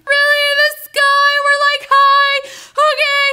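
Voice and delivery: high, loud frightened squeal; acted panic. Terrified rider peeking down from the coaster, panicked squeal. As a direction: squeal in hammed-up terror.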